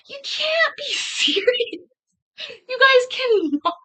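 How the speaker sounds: very high, drawn-out incredulous squeal; laughing disbelief. Real, not acted. Laughing disbelief at viewers' comments.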